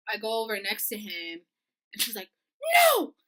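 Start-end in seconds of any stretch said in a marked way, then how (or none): 2.7-3.1 high, loud yelp; imitated roommate's piercing no; alarmed cry. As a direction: yell it suddenly in alarm.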